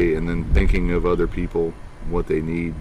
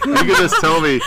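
high, laughing through the words; 10 semitones higher, 6 dB louder than usual. Real, not acted. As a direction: say it while cracking up. Laughing at friend's imitation.

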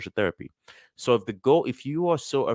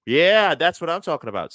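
higher and louder hype; mock cheering; 8 semitones higher, 8 dB louder than usual. Friends hyping up the celebration, loud cheer. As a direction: cheer like a buddy pumped up.

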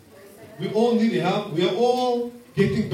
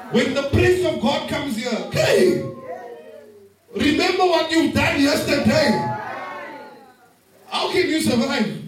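mock alarm; 5 semitones higher than usual. Preacher mock-shouting at the congregation about sins. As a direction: play up the shock of being caught out.

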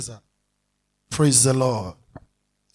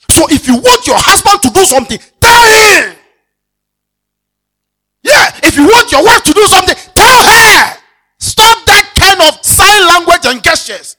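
loud shouted sermon climax, higher; preaching at full force; 8 semitones higher, 12 dB louder than usual. Acted. Preacher shouting at couples to speak plainly. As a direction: hammer the command home to the church.

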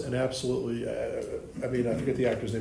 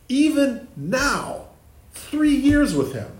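much higher, louder and slow; emphatic exclamation; 13 semitones higher, 8 dB louder, much slower than usual. Real, not acted. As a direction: drive it home in disbelief. Teacher stressing disciples' failure.

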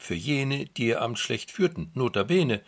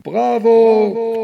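high, loud cheering shout; crowd cheering; 12 semitones higher, 15 dB louder than usual. Acted. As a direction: cheer for the emperor. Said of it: "Sie riefen".

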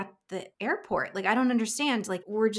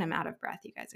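plain reading voice

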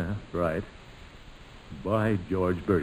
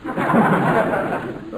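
chuckle; 11 semitones higher, 4 dB louder than usual. Real, not acted. Gildersleeve laughs at cigar joke.

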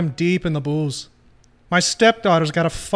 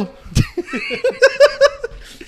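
high shrieking laugh; 5 dB louder than usual. Real, not acted. Laughing after the bit.

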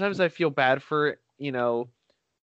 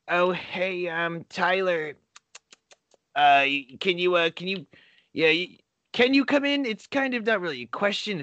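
higher voice, halting and feeling around for words; hesitant mock requester; 6 semitones higher than usual. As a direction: imitate someone awkwardly easing into an unwelcome request. Voices the text asking him in.

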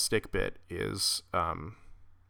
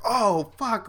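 shocked crowd; 11 semitones higher, 3 dB louder than usual. Retold or imitated. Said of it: "people were like".

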